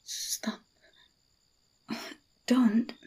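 barely voiced stop, almost a whisper; whispered protest. Held in his arms, whispered protest. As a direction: push back in a whisper.

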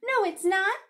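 put-on high, very loud child's shout; loud, insistent contradiction; shouted denial. A narrator's reading. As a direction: shout back in a playground quarrel. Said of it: "shouted Ruthie".

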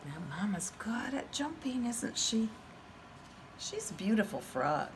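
soft whispered aside; whispering. Real, not acted. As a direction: lean in and whisper. Aside to the children.

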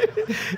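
very high mimicked giggle; imitating Keith's laugh. Showing how Keith laughed, high imitated giggle. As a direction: giggle high, in short squeals. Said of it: "I like the way Keith laughed".

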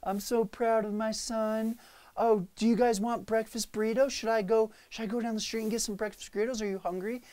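mock-excited proud mom; imitating mom. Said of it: "she's like".

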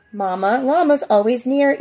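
plain reading voice

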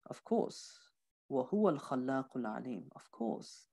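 a reverent, voiceless aside; hushed whisper. Acted. Teacher whispers the answer to himself in awe. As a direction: breathe the words in a whisper.

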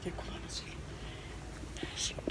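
a secret whisper for her ear. Real, not acted. Mother whispering to her daughter to tell a secret.

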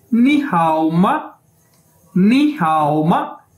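नी हाओ माँ slow, rising on नी; pronunciation demo. Shown as a demonstration. Teacher models नी हाओ माँ for students, slow and careful. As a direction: spell out नी हाओ माँ slowly with a lift on नी. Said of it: "ये इसकी pronunciation है".

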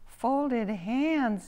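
high-pitched exclamation; startled declaration. A narrator's reading. Pidge objects to the rule just read, high declaration. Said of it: "declared Pidge".

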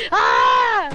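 loud mock scream "Aah!"; imitated scream. Gamer imitating someone screaming in a video, mock scream. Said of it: "He starts screaming like".

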